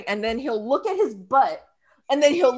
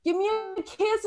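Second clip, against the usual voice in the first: coaxing husband; 6 semitones higher, 7 dB quieter than usual. Retold or imitated. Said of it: "he tells me".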